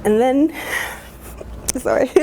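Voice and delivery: choked up, breaking off to apologize; real tearful catch in the breath; emotional. Real, not acted. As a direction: try to go on, then give way to tears. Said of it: "I'm very emotional".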